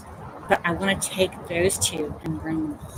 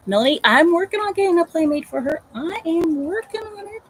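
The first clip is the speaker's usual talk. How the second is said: excited; 10 semitones higher, 6 dB louder than usual. Real, not acted. Reassuring viewer eagerly.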